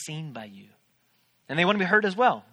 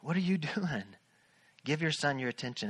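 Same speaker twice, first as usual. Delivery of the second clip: little inner voice; 3 semitones lower, 7 dB quieter than usual. Retold or imitated. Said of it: "this little voice say".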